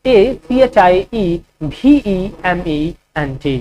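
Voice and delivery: slow letter-by-letter dictation; slow spelling dictation; spelling out slowly. Shown as a demonstration. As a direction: spell each letter clearly for students. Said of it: "achievement का spelling क्या होगा".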